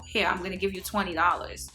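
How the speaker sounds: usual speaking voice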